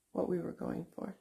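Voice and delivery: ordinary talk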